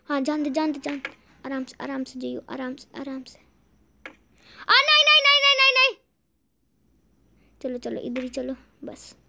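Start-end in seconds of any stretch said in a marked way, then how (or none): 0.1-3.4 soft, careful guiding; hushed caution. Real, not acted. Carefully guiding a delicate move. 4.7-6.0 high, frantic string of no's; panicked cry. Real, not acted. About to fall in game.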